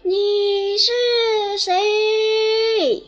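high ringing echoed question; canyon echo. Acted. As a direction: answer like a mountain echo. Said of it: "大山也回答".

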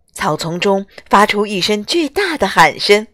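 plain reading voice

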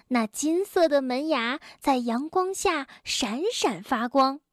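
plain reading voice

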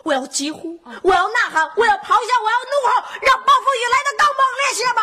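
climbing from a loud call to a fast, high roar; building to a roar. Acted. Kid grandly roaring his grievance at his brother. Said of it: "要大声地说".

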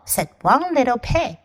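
normal talking voice

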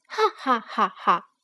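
four loud, separate acted ha-has; hammed-up bear laugh, evenly punched; little bear's laughter. Sleeping bear laughs from the tree hole, hammed-up laughter. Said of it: "树洞里传出来小熊的笑声".

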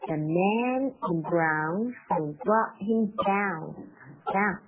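slow, stretched MAN, BROWN and DOWN; stress on BROWN, DOWN. Shown as a demonstration. Teacher modelling stressed words, MAN, BROWN, DOWN lengthened. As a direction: draw out MAN, BROWN and DOWN with emphasis. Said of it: "需要一些重读的音有brown，down".

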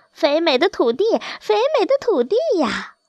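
high goose cry, mildly scolding; reproachful call. A narrator's reading. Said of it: "便不无责备地喊道". (略带责备地高声喊)

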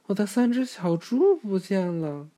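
high, loud worried cry; a mother pig panicking over lost piglets; anxious. Acted. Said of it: "猪妈妈焦急地说".

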